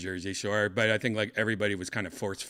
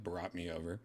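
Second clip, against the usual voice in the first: ordinary talk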